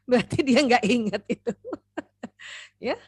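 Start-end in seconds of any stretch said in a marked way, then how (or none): none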